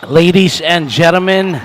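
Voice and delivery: big, loud, stretched crowd opener; rally the whole arena; loud announcer. Acted. Commentator opens game to crowd.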